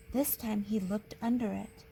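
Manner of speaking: ordinary delivery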